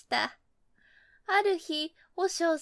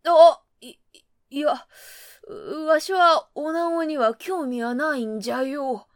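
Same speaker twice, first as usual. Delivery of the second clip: put-on flustered old monk; 8 dB louder than usual. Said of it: "顔を真っ赤にしながら".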